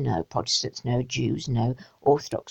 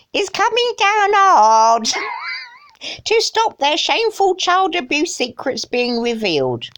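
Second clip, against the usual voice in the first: high, drawn-out put-on quote voice; mock dramatic reading; 10 semitones higher, 3 dB louder than usual. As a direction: ham up the quote, pitched high.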